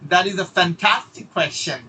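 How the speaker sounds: "fantastic" hit hard and slow; slow, stressed "fantastic" with energy; energetic word stress. Shown as a demonstration. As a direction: lean into "fantastic". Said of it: "আপনাকে এই এনার্জিটা দিতে হবে".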